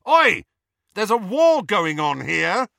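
loud, high shout of "Oi!". A narrator's reading. Harry yells at kissing Ron and Hermione, loud shout. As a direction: yell to break them apart. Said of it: "he raised his voice".

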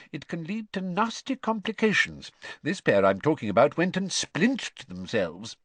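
lively, confiding explanation; earnest explaining father. A narrator's reading. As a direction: warn the listeners as a knowing dad. Story figure, father explaining.

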